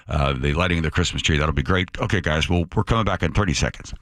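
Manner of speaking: low, businesslike director in the earpiece. Retold or imitated. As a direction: run through the cues in a low, even voice. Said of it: "the director says in our ear".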